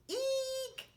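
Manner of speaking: high squeaky eek; scared mouse squeak. Shown as a demonstration. Showing children how a scared mouse squeaks, high squeak. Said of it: "Can you say eek like a scared mouse?".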